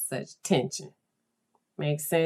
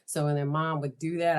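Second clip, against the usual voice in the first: ordinary delivery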